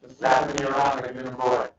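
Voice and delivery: ordinary talk, nothing marked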